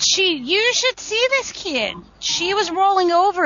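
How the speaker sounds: high, slow excited bragging; proud and excited. Real, not acted.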